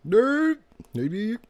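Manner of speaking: very high, short squeaked words; mock squeak jumping way up in pitch; silly squeak. Friend interjects into the bra story, in a silly high squeak. Joking interjection of imagined reaction.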